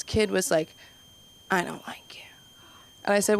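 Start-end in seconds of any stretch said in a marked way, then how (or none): 1.5-2.2 put-on hushed, barely audible kid's confession; whispered insult. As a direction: whisper it under your breath like a grudging child. Kid's muttered insult, asked to repeat.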